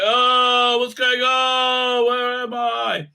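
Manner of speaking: high, slow, dazed half-shout; imitating Obi-Wan waking up confused and shouting; groggy waking up. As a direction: come to in confusion and cry out. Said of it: "Obi-Wan goes like".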